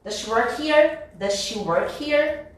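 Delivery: DOES SHE WORK HERE with DOES SHE as "duh-shee"; one SH joining DOES SHE in DOES SHE WORK HERE; linked speech. Shown as a demonstration. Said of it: "Eu junto como se fosse um S só".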